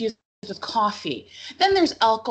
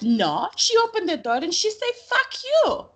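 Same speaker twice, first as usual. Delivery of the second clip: rising higher and louder to a squeaky quoted curse; guest telling on sister; 10 semitones higher, 4 dB louder than usual. Retold or imitated. Guest tells the mom what her daughter said, rising high. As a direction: build up and pop the quote high. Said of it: "And she goes like this".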